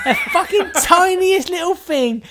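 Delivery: high, slow, loud moaning; mock whiny listener. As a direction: whine like a fed-up fan. Mocking listeners' complaint.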